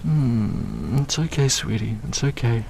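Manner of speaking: ordinary delivery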